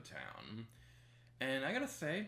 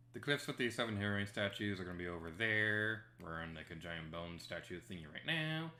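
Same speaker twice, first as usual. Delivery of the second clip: ordinary talk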